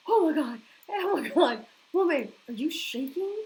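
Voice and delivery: shocked. Real, not acted. Reacting to a viewer's huge caffeine intake with loud shock. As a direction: cry it out, truly floored.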